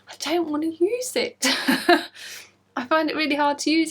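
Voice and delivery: laughing through the confession. Real, not acted. Knitter confessing to viewers, laughing. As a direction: giggle it out, half embarrassed.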